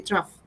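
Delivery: trough said as "troff", rhyming with off; soft, low model word trough, short o and a final f sound; pronounced for the class. Shown as a demonstration. Teacher showing students how to say the word trough, spoken softly as "troff". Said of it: "this is how you pronounce it".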